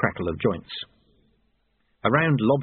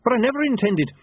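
flustered protest; 13 semitones higher, 3 dB louder, faster than usual. A narrator's reading. Apprentice tries to protest.

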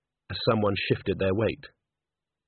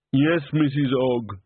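slow simple man; 3 semitones higher, slower than usual. A narrator's reading. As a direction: answer slowly like a simple soul.

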